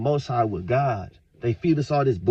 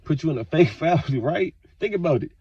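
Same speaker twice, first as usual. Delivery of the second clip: ordinary talk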